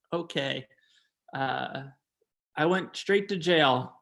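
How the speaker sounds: usual speaking voice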